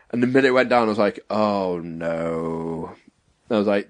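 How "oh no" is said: low, soft, drawn-out groan; mock dismay, dropped low; retold sinking dread. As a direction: say it sinking, quietly. Retold sinking feeling after chugging.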